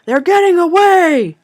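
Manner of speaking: high, very loud alarm cry; yelling. A narrator's reading. Man chasing mother and daughter, yelling to the crowd. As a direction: yell after escaping prey to alert the crowd.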